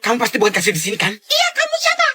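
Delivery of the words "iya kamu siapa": put-on child voice. Child voice confronting cashier.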